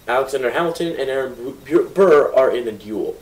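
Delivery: ordinary talk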